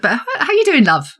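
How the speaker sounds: light Yorkshire greeting, pitched high; hint of a Yorkshire "love". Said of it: "everybody calls everybody duck or love".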